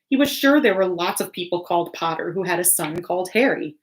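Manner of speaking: ordinary delivery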